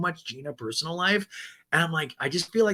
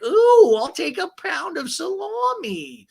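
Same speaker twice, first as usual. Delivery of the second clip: high, loud "Ooh" then a slow, high drawl; high squealy Gina; 6 dB louder, slower than usual. Retold or imitated. Gina at the Italian market, high, slow, giddy squeal. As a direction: coo it out like a giddy shopper. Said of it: "And Gina's like".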